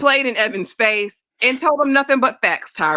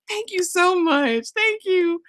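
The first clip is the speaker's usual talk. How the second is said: high, slow, syrupy mock gratitude; gushing thanks; 9 semitones higher, slower than usual. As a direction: put on a sugary grateful girl voice. Mock Lucy thanking gratefully.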